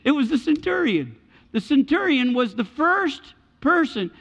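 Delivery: high, loud, emphatic proclamation; full-force preaching. Real, not acted.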